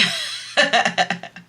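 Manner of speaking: high, airy giggle; laughing. Real, not acted. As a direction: laugh at the absurd rule. Laughing at frog law.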